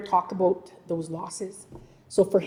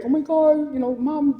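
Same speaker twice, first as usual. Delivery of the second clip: higher-pitched imitation of a scared mother; retold alarm; 7 semitones higher, 4 dB louder than usual. Daughter reporting her son's words to her mom, mildly alarmed. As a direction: tell it as if mildly panicked. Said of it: "at first I was scared".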